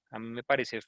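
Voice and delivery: ordinary talk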